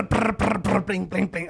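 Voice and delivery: high trilled whirrs into ringing blings; loud, high slot-machine noises; imitated slot machine. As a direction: roll the brrs like spinning reels.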